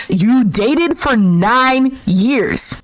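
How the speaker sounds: slow, drawn-out stress on "nine years"; indignant scolding. Pretend. Host scolds the man for not caring, indignant and emphatic. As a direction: spell out "nine years" slowly, word by weighty word.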